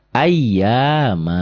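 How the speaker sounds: ayyama slowly, final a held long; stretched ayyama, ending open without n; long stopped ending. Shown as a demonstration. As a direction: model ayyama with a drawn-out last vowel. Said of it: "mad iwad kalau dihentikan".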